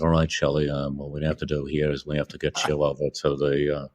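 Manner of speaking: Kubrick's Bronx voice. Retold or imitated. Kubrick directing Shelley on set, low Bronx mumble. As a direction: rattle off directions like a Bronx guy. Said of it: "He starts talking like this".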